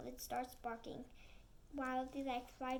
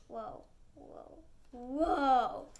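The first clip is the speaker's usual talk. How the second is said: hushed then loud whoa; awed whoa; 10 dB louder, much slower than usual. Acted. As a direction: gasp at the sight. Freezing at the sight.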